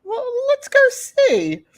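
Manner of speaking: high, drawn-out hesitant agreement; hesitant mom. Retold or imitated. Mom answers friend's dumpster request, hesitant. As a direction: voice a reluctant mom, slow and high. Retelling the mom's hesitant reply.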